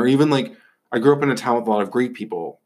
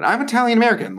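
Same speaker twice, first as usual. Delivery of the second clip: much higher, sing-song parody of a proud Italian American; mocking Italian American; 13 semitones higher, 4 dB louder, faster than usual. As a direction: sneer the heritage line in a high mock voice. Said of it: "oh my God".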